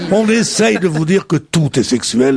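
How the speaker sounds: usual speaking voice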